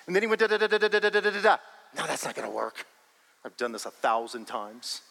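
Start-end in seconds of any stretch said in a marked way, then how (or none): 0.4-1.6 mock busy babble. Man mimics his busy planning. 1.9-5.0 weary sigh. Retold or imitated. Weary man gives up on plans.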